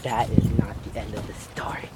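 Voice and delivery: low, unvoiced creepy whisper. Real, not acted. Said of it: "you sound like a pedophile".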